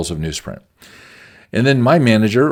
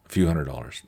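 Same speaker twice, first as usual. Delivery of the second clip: lower, softer repeat of the amount; quiet echo; 6 semitones lower, 8 dB quieter than usual. Real, not acted. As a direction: say it again under your breath, downplaying. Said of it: "repeating the small amount".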